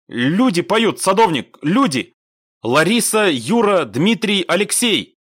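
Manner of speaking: high, loud, word by word; insistent radio call. A narrator's reading. Squad leader correcting misheard message, insistently loud.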